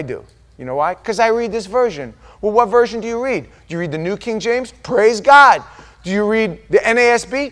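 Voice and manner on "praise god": loud, high burst of praise; approving exclamation. Acted. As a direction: cheer it out to the congregation. Cheers each version someone reads.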